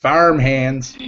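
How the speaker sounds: put-on half-hearted Texas cowboy accent; badly faked Texan. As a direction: have a weak go at a cowboy twang. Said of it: "I can't even do, like, a cowboy accent".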